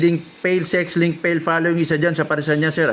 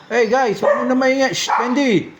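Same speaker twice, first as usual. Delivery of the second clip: shouting at the dog; 8 semitones higher than usual. Real, not acted. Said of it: "napasigaw ako".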